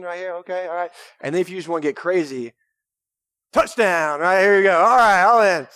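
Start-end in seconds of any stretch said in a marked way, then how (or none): 3.5-5.7 loud, high touchdown cheer; exuberant shout. Shown as a demonstration. Preacher showing wild hand-raising in worship, shouted. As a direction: whoop it up like a fan at a score. Demonstrating getting crazy in worship.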